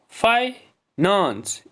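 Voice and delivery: "Finance" slow, split as फाइ, नेंस; long pause in "finance" after फाइ; syllable by syllable. Shown as a demonstration. Teacher splitting the next word for pupils, syllable by syllable. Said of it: "इसमें भी दो syllable हैं".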